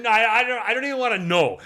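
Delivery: high, loud mock panic; mock dread. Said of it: "I said".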